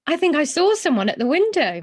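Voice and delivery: high, fast excited cry; excited call. A narrator's reading. Young bear calls excitedly to Mister Gruber at the palace window. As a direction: blurt it out thrilled, calling to Gruber. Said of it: "he called excitedly".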